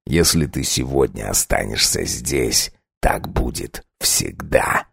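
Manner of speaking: low unvoiced threat; menacing whisper. Vampire threatens wife.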